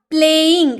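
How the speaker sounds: "प्लेइंग" said as two beats, प्ले then इंग; syllable demo. Shown as a demonstration. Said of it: "क्या इसे बोल कर देखिए".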